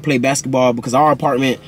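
ordinary talk

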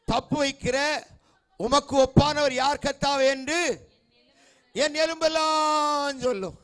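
ringing scripture recital, phrase by phrase; preaching declamation. Acted. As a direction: declaim the verse, stretching the last words. Declaims a psalm verse to congregation.

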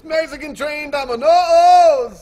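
high, very loud, drawn out; hammed-up stretched shout; mock announcer holler. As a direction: bellow it slowly like a showman.

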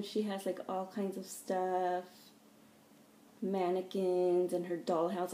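ordinary talk, nothing marked

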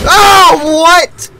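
high, loud disbelief; startled, indignant high cry; shocked outcry. Real, not acted. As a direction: yelp in sudden shock. Flashlight gets punched.

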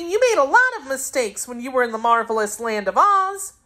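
put-on high childish teasing voice; a young girl teasing the Wizard, high pitched; little girl Dorothy. A narrator's reading. Dorothy's voice in the story.

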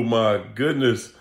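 usual speaking voice

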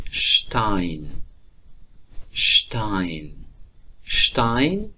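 teacher drilling شتاین; st said as sht. Shown as a demonstration. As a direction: say شتاین as shtain.

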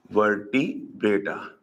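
VERTEBRATA low and slow, split after verte; slow breakdown. Shown as a demonstration. Teacher repeating the heading for children to note, slowly split. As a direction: say "Vertebrata" in two parts with a break.

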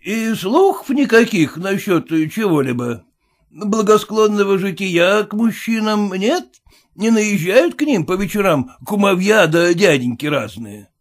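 blunt merchant scoffing at formality; gruff merchant. Acted. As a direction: push in like the owner. Merchant prying.

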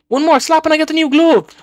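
high, loud burst of anticipation; real excitement. Real, not acted. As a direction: cry out, one hit from the prize. One slap from new glove.

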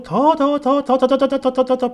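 high, held pitch, rattled off; relentless rapid-fire "더, 더, 더"; insistent piling-up repetition. Acted. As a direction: pile up each "더" as escalating demands. Mimicking ever-growing demands.

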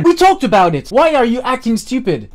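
loud, high-pitched reproach; exasperated retort. Retold or imitated. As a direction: argue back with rising frustration. Retold argument, losing patience.

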